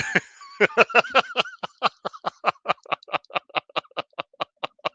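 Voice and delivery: long, high cackling laugh that tails off; long laughing fit. Real, not acted. Host losing it over South Park at Comic-Con, long laughing fit. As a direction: crack up and keep laughing.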